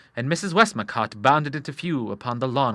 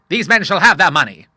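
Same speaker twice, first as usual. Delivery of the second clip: loud, higher Admiral; emphatic declaration; 6 semitones higher, 9 dB louder than usual. A narrator's reading. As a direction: declare it firmly at full voice. Admiral declares debts will be paid.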